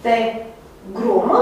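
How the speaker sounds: integrum drawn out slowly, every syllable clear; Latin word spoken slowly. Shown as a demonstration. Teacher showing students the Latin word, slow and careful. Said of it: "cuvântul, uh, latinesc".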